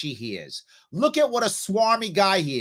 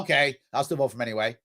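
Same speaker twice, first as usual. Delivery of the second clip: mock resignation; 8 semitones lower, faster than usual. Mocking conservative voters' excuse.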